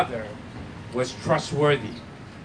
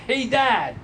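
raised, higher call to dad; mild imitation of a boy yelling; mock yell; 6 semitones higher than usual. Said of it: "then he yelled".

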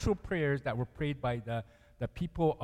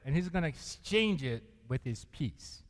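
usual speaking voice

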